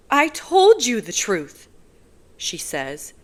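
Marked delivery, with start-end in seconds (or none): 0.1-1.7 higher, loud, slow retort; angry woman defending herself; flaring anger. Acted. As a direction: snap back in sudden anger. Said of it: "anger flaring in her eyes".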